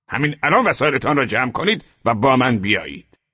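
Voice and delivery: high, loud berating officer; scornful scolding. A narrator's reading. SS officer berating the boys as cowards, loud and scornful. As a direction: lecture them with contempt.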